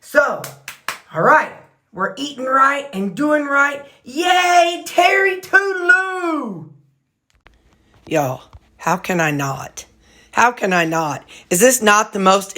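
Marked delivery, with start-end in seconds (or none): 4.0-6.7 high, slow gleeful cheer. Real, not acted. Owner cheering her weight loss, high and gleeful. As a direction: sing out a happy cheer.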